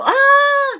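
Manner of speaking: loud, very high "Ah!"; sudden high squeal of surprise; excited squeal. Real, not acted. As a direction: let out a delighted, high-pitched cry. Reacts to a correct guess.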